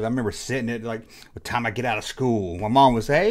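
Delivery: usual speaking voice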